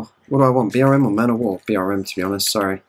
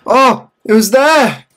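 surprised shout; 11 semitones higher, 6 dB louder, much slower than usual. Real, not acted. Sudden discovery in game.

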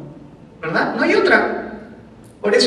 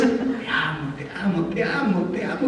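hushed, tender, much softer; soft loving murmur; 4 semitones lower, 9 dB quieter than usual. Pretend. Husband soothing his angry wife, soft tender murmur. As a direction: murmur it lovingly under your breath.

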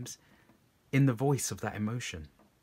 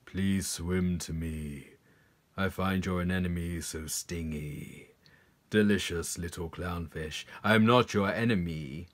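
luring predator fish; 7 semitones lower than usual. Acted. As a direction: lure the little fish in, low and silky. Predator fish lures the clownfish.

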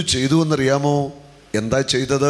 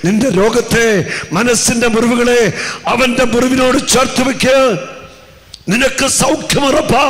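high, loud pulpit proclamation; full-force preaching; 7 semitones higher, 5 dB louder than usual. Acted. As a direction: preach it hard over the music. (ഉച്ചത്തിലുള്ള പ്രസംഗം)

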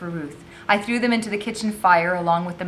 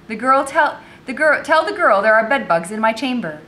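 louder, higher voice giving orders; imperious mistress; 5 semitones higher, 7 dB louder than usual. A narrator's reading. Mistress ordering the girl about, imperious. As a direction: call out a command to the servants.

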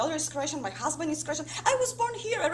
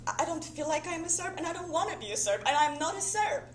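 higher-pitched, insistent denial; agitated protest; 6 semitones higher, 4 dB louder, faster than usual. Acted. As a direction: protest harder with each clause. Agitated protest in her own thoughts.

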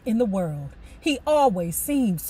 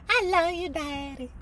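put-on sweet child; 7 semitones higher, 4 dB quieter than usual. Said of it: "Ronnie like".

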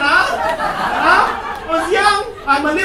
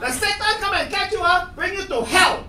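mock threat; 11 semitones higher, 5 dB louder than usual. Classmate repeats teacher's threat.

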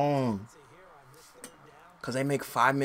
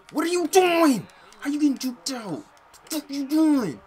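high, loud real rage at the screen; furious yelling; 9 dB louder than usual. Real, not acted. Gamer yelling at his safety over a blown play, furious. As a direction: scream at the useless safety.

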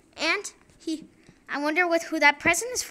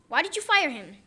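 ordinary talk, nothing marked

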